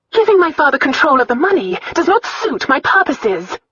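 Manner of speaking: high, loud, firm declaration; angry announcement. A narrator's reading. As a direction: announce it with indignant force. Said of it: "she announced".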